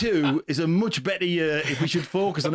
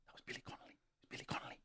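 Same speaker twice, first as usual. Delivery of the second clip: whisper; 24 dB quieter, much faster than usual. Retold or imitated. Excited hushed recognition to friends.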